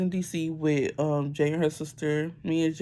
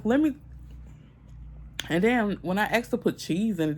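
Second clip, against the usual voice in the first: ordinary talk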